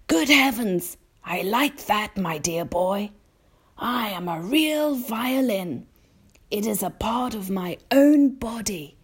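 put-on high, tickled elderly insect; old grasshopper. A narrator's reading. Old grasshopper tells James about his violin, proudly delighted. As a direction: exclaim high, then explain with pride. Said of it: "the old green grasshopper cried".

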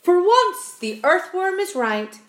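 high, loud exclamation; astonished cry. A narrator's reading. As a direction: agree loudly in amazement. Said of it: "cried the centipede".

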